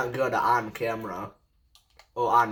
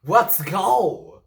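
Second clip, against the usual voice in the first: loud, high victory shout; excited cheer; 7 semitones higher, 7 dB louder than usual. Real, not acted. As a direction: yell it out in triumph. Celebrating first win.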